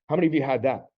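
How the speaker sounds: ordinary talk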